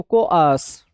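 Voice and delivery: कोआर्स said in two beats, "ko-aars"; careful pronunciation. Shown as a demonstration. Teacher showing students how to say कोआर्स. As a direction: spell out कोआर्स clearly as "ko-aars". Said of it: "तो कोआर्स बोलते हैं इसको".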